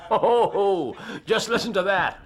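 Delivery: amused, laugh as you speak. Acted. Holmes amused by arriving guest.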